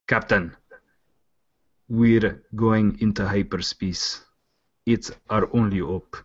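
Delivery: slow, halting attempt at South African; badly done South African accent with long gaps. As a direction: try a shaky-grade South African accent, measured. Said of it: "Is, is that South African?".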